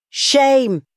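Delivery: high shouted protest; cried out. A narrator's reading. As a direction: fling out a heckle. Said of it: "cried Witherspoon".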